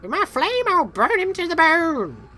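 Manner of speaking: high, slow cackling witch; menacing witch villain voice. Acted. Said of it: "my witch voice".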